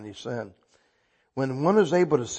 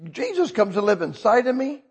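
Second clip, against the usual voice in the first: excited; 9 semitones higher, 6 dB louder than usual. Real, not acted. Excited testimony continues.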